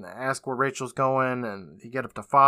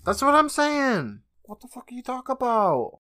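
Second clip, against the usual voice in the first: can't-believe-it outburst, pitched way up; exasperated disbelief; 11 semitones higher than usual. Real, not acted. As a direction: stretch it out high, utterly baffled. Incredulous reply about horror movies.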